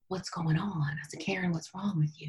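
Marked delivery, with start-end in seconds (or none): 0.1-0.9 very soft, near-whispered question; hushed self-talk. Retold or imitated. Retelling hushed talk to herself. 1.2-2.3 very soft, near-whispered question; hushed self-talk. Retold or imitated. Retelling hushed talk to herself.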